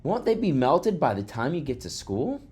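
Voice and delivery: high-pitched questioning schoolboy; loud voice. A narrator's reading. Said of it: "he said in a loud voice".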